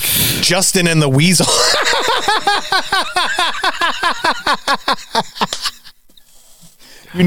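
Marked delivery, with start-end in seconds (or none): 1.5-5.7 long, high run of laughter; real laughter that keeps going. Real, not acted. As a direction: crack up and keep laughing, high. Laughing at suggested radio name.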